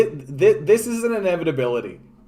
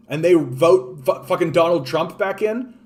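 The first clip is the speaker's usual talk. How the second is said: ordinary talk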